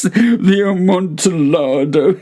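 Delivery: high, slow laughing voice; laughing Fortunato. A narrator's reading. Walled-in prisoner repeating the wine's name, laughing high. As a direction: draw it out with a nervous laugh.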